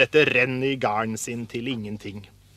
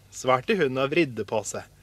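plain reading voice

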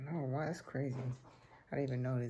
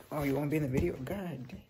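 usual speaking voice

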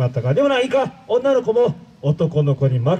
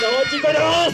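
high, pushing shout of おーす; louder call; 7 semitones higher, much faster than usual. Acted. Villain demands a louder おーす from the crowd. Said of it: "もっと大きい声でおーす".

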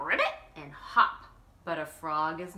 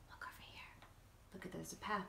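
hushed whisper; 3 semitones lower, 20 dB quieter, much faster than usual. Real, not acted. Reader whispering to children about the picture. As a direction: point it out softly.

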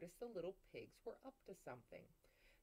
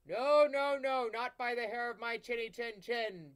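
louder, lightly voiced big pig; booming pig; 5 semitones higher, 14 dB louder than usual. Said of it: "boomed the pig inside".

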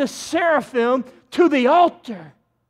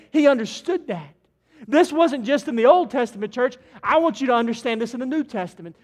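normal talking voice

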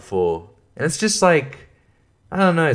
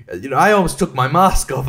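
ordinary delivery

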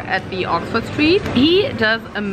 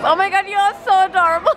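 high, quick adoring squeal; gushing; 10 semitones higher, faster than usual. Real, not acted. Vlogger gushing over someone adorable, high and excited. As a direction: gush it high and fast.

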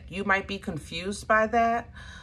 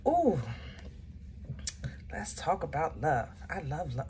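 calmed down; 3 semitones lower, 7 dB quieter than usual. Real, not acted. Reader calming herself after excitement, soft and low. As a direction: lower and softer, settling herself down. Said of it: "Okay, let's calm down".

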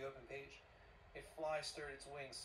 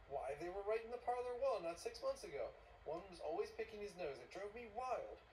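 exasperated; 7 semitones higher, 4 dB louder than usual. Acted. Montag argues heatedly about politics.

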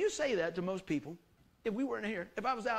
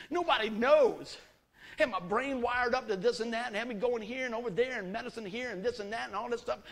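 preaching at full force; 4 semitones higher, 6 dB louder than usual. Acted. Preacher to congregation, retelling his illness, loud and driving. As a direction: build the congregation up with the story.

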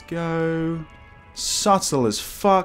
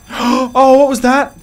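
high, loud startled gasp; startled yelp of fright; scared horror game streamer; 12 semitones higher, 8 dB louder, much slower than usual. Real, not acted.

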